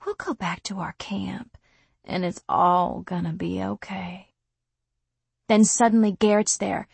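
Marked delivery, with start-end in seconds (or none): 0.1-4.3 hushed, menacing whisper; creepy whisper. Acted. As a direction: whisper it like a false comfort. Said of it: "he tells me quietly".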